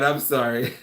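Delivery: ordinary talk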